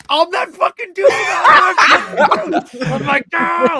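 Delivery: high, loud squeal of inner hype; ecstatic mock scream. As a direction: shout it like an overjoyed fan. Acting out his inner elation.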